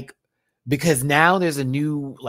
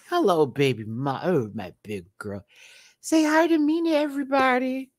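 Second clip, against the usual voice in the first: pet talk; 14 semitones higher than usual. Real, not acted. Talking to her cat.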